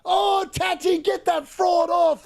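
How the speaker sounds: high, shouted play-by-play as the ball hits the post; excited commentary. Real, not acted. Streamer calling the match live, rising to a shout. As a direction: call the near miss at full pitch.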